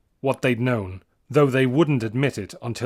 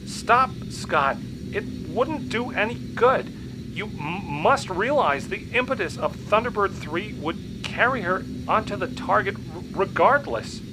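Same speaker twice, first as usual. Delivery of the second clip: high, stuttering boffin; stammering scientist; 8 semitones higher than usual. A narrator's reading. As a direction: stammer it urgently.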